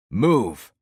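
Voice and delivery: a sharp, loud bellow. A narrator's reading. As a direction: bark it out to clear the way. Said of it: "I bellowed".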